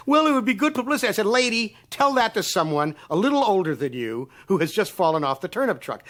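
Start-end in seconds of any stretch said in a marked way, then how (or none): 0.1-1.1 high, quick mocking lady voice; airy little mock voice; mimicking the caller. Mocking the woman who wanted a freebie. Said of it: "I says". 1.3-6.0 telling her off; indignant scolding; retold rant. Telling off the caller asking for free work.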